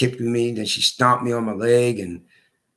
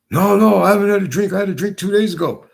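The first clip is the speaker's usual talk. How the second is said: higher and louder, protesting innocence; defensive denial; 7 semitones higher, 4 dB louder than usual. Retold or imitated. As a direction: quickly insist you haven't been drinking. Deputy's defensive denial retold.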